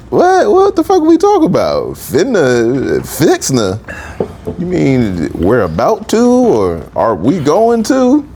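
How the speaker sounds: high-pitched, exaggerated bafflement; hammed-up disbelief; incredulous confusion. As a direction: act utterly lost at unfamiliar slang, voice leaping up. Retelling his bafflement at Georgia slang.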